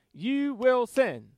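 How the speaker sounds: slow, weighty stress on "You will sin"; emphatic. Shown as a demonstration. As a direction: spell out "You will sin" word by word, firmly. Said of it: "But it's emphatic here".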